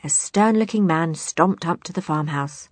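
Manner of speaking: plain reading voice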